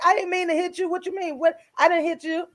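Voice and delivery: high-pitched retold protest; baffled denial, pitched well up; defensive denial. Wife answers her husband's complaint at night, defensive denial.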